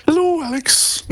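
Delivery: saludo agudo y juguetón; voz aguda de broma; squeaky hello. Acted. Playful high greeting.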